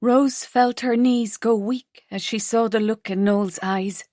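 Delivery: plain reading voice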